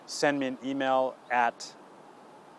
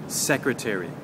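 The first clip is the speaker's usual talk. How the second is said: "secretary" stressed on the opening SEC; stress on first syllable. Shown as a demonstration. Teacher modelling a word for learners, first-syllable stress on "secretary". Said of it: "just a difference in the stress".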